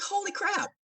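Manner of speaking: high, amazed exclamation; stunned. Retold or imitated. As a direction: gasp it out in disbelief. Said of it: "I was like".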